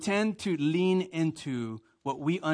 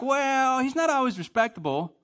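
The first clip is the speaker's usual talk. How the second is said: mock excuse-making; 10 semitones higher, 5 dB louder than usual. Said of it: "And you're like".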